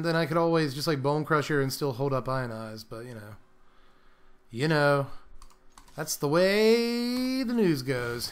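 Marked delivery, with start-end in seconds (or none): 5.9-8.3 higher, slow put-on anchor voice; mock newsreader. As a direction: sign off like a TV anchor.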